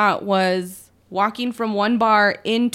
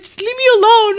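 very high, louder crying plea; sobbing outburst, much higher and louder; tearful yell; 12 semitones higher, 6 dB louder than usual. Retold or imitated. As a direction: wail it to push him away. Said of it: "I was like".

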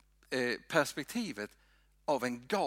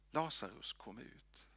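softer, fading into a whisper on kom ut; hushed call; 3 semitones lower, 9 dB quieter than usual. Retold or imitated. Preacher retells Jesus calling Lazarus in a hushed voice. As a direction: let the call die into a whisper.

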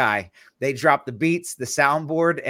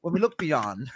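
nature-documentary narrator; 2 semitones higher, 3 dB quieter than usual. Acted. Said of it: "It's always like".

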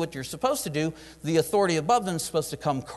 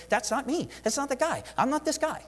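Nehemiah protesting his calling to God, voice jumps much higher; pleading high protest; 10 semitones higher than usual. Acted. Nehemiah insisting to the Lord he's not the man, in a high pleading voice. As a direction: shoot up high, pleading it's not you.

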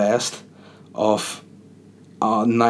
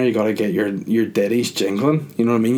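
ordinary talk, nothing marked

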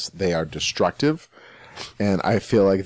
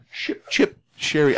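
mock British accent; 7 semitones higher, slower than usual. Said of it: "a British accent would probably be best".

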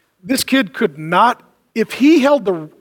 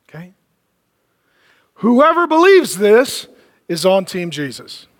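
usual speaking voice

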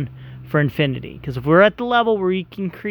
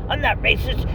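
ordinary talk, nothing marked

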